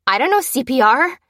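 high, loud flustered protest; flustered stutter. A narrator's reading. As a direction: panicky admission of helplessness. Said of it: "stuttered the clerk".

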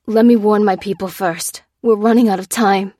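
loud, urgent plea; imploring. A narrator's reading. Cop begs to warn people first, imploring. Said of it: "implored Priestley".

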